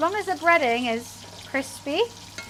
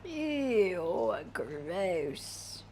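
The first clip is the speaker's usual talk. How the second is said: disgusted; 6 semitones lower, 8 dB quieter than usual. Real, not acted. Touching raw egg.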